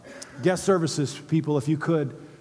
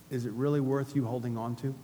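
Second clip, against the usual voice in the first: soft question; 5 semitones lower, 7 dB quieter than usual. Shown as a demonstration. Repeated question, quieter.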